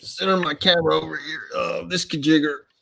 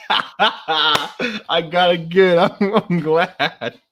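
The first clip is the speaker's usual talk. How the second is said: higher, drawn out through laughter; laughing delight; 4 semitones higher, much slower than usual. Real, not acted. Pleased with own Texas accent, laughing. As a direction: laugh through the words, stretching them.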